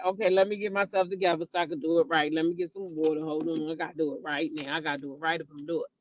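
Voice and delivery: fast, quiet mimicry of him stalling; mocking imitation. Vlogger mocks a livestreamer stalling before repeating himself, quiet and fast. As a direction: rattle it off low and hushed, mocking. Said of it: "Y'all remember when he said".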